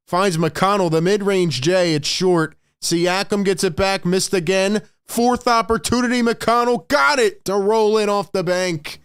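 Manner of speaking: play-by-play build to a shout; rising excitement. Acted. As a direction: build the call to a peak on the bank shot. Scramble of repeated shot attempts.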